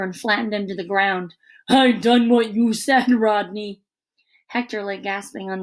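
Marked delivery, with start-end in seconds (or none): 1.7-3.8 put-on slow-witted big kid, a bit louder; lightly voiced hulking teammate; dim Josh. A narrator's reading. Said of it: "Josh voice".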